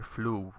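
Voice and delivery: "fluvius" with the accent on the first syllable, FLU-vius; stress on the u. Shown as a demonstration. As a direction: lean on the u of "fluvius". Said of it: "you put the accent on the u here".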